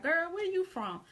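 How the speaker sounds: mock surprised questioning. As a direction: play someone puzzled by a stranger's accent. Acted reaction to unfamiliar accent.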